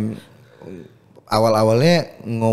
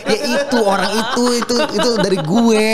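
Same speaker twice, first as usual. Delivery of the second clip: high, laughing reply as God; 11 semitones higher, 4 dB louder than usual. Retold or imitated. God laughing at the drowned man's complaint, high and amused. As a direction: play God chuckling at the foolish man.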